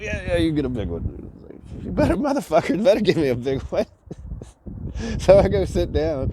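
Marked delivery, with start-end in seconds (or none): 0.0-1.3 higher imitated Indian English; polite Indian accent. Hotel clerk granting a big beer, lightly mocked Indian accent. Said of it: "with Indian accent, but very polite". 1.8-3.9 high, comic outrage; mock indignant retort. Tired guest to hotel clerk, high mock indignation.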